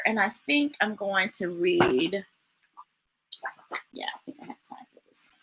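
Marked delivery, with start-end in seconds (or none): 3.9-5.4 muttering to herself. Real, not acted.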